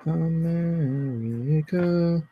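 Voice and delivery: slow, stretched proud cry; lightly hammed-up patriotic call; drawn-out patriotic drawl.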